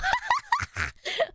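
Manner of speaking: very high squealing laugh; overwhelmed laugh. Real, not acted. Streamer overwhelmed by her viewers' gifts, high squealing laugh.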